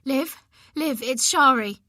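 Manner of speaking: urgent and breathless on the phone. Acted. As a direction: rush it out, alarmed. Urgent phone call from friend.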